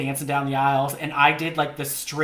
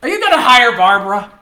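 much louder, high pretend question; mock incredulous pitch; 7 semitones higher, 10 dB louder than usual. Pretend agent asking client about hiring, mock incredulous pitch. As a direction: belt it out as a disbelieving challenge.

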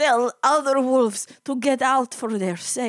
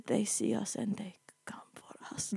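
hushed whisper; 11 dB quieter than usual. Acted. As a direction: whisper it like you're hiding. Telling of hiding in war.

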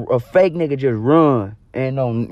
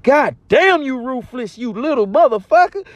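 mock shocked outcry; 10 semitones higher than usual. Shocked by robber's threat.

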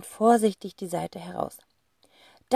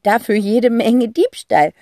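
snorting laugh; 4 semitones higher, 7 dB louder, slower than usual. Acted. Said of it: "prustet Zack".

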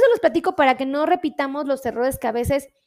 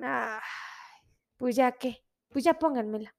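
resigned; 4 dB quieter than usual. Acted. Uncle Juan gives in to insulin.

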